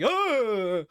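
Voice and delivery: high, loud mock "ah" of fright; mock startled yell. Kid backing away from the cupcake, mock startled yell. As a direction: yelp as if startled, hammed up.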